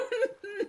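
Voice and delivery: breathy high-pitched laugh; a sudden squeaky giggle. Real, not acted.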